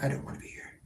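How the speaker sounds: whispered pretend cat voice; whispered cat line. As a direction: whisper the cat's complaint. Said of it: "She's like".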